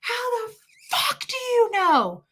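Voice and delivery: high, drawn-out strained screech; enraged scream. Retold or imitated. Woman screaming at mother holding her baby, enraged screech. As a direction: screech it slowly, far above normal pitch. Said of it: "She would start screaming".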